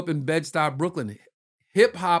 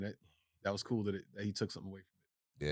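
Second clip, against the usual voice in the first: quiet inner thought; 6 semitones lower, 11 dB quieter than usual. Retold or imitated. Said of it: "and I'd be like".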